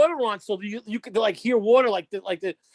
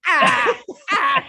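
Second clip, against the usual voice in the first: straining groan; 2 semitones higher, 5 dB louder than usual. Retold or imitated. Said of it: "I let it go out like".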